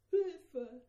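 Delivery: high, loud shriek of terror; terrified disciples screaming for help in the storm; panicked cry for help. Acted. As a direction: scream it like drowning men.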